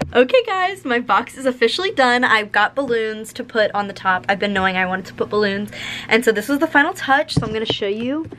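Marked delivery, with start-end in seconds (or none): none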